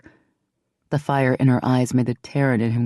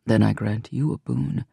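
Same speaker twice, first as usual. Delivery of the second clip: lower, rough-edged man's voice; deep male voice; 4 semitones lower than usual. A narrator's reading. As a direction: voice the king low and steady.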